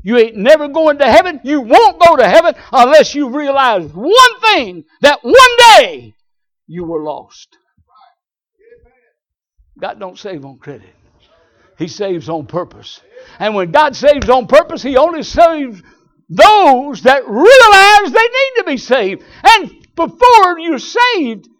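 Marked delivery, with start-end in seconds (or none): 0.0-7.6 high, loud pulpit shout; preaching at full force. Acted. Preacher rebuking listener who claims heaven, shouting. 13.4-21.5 high, loud and slow preaching; preaching shout. Acted. Preacher proclaiming salvation to the church, full shout.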